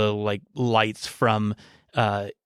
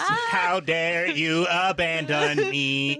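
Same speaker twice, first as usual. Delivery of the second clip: eldritch fridge voice; 4 semitones higher, slower than usual. Acted. GM voices vengeful squid fridge.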